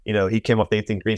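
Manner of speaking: ordinary talk, nothing marked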